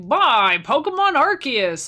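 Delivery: slow, loud, high ad-announcer pitch. Retold or imitated. Mimicking the ad on stream, big slow announcer read. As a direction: sell it like a TV ad. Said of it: "And they're like".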